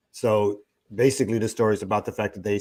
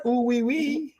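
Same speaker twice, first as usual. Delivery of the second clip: much higher, squealed "Ooh, wee, wee"; silly squeal; slower than usual. Retold or imitated. Host repeating his greeting to Jackie as a high squeal. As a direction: squeak it out as a goofy inside joke. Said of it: "I said".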